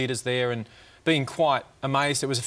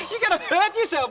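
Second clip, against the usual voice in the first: mock warning; 4 dB louder than usual. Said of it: "She'd go".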